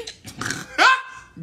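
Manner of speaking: short, high burst of laughter; high cackle. Real, not acted. Streamer laughing at man's pricey hat, high cackle. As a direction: cackle once, rising high. Laughing at her own joke.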